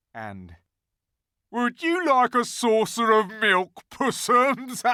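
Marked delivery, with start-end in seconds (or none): 1.5-4.8 high, loud mocking creature voice; jeering. A narrator's reading. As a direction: coo it out in cruel mockery. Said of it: "jeering at him".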